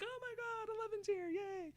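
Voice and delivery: very high-pitched mock squeal; excited. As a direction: cheer in a tiny, delighted falsetto. Acting out an excited reunion.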